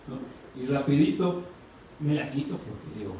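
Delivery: usual speaking voice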